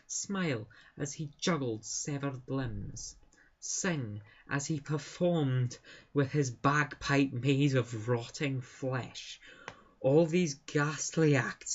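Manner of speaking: slow storytelling in a put-on Scottish accent. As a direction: draw out the ghastly tale in Scottish. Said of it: "I'm usually better at a Scottish accent than that".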